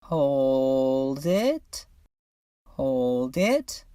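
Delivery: stretched words with a rising lift at the end; drawn-out coaxing. Acted. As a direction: coax them to keep holding, voice climbing. Coaching someone holding in smoke.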